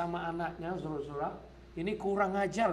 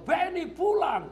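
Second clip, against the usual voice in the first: high, loud, slow angry call; father's shout; 11 semitones higher, 4 dB louder than usual. Retold or imitated. Father calling his son home from the river, loud angry shout. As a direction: bellow the boy's name and order him home. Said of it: "saya ngomong".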